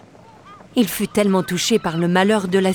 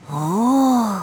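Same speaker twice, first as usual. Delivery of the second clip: amazed oh; 3 semitones higher than usual. Acted. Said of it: "s'exclama Sam, stupéfait".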